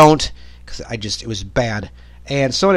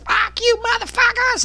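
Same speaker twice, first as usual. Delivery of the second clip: mock shriek, much higher; screaming woman. Woman yelling at cops, mock shriek. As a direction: squeal it like a raging woman in the ER. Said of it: "this woman's like".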